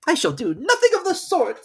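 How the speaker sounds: high, loud huffy; offended mouse. Acted. Mouse walking off, high and offended. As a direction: huff and turn away.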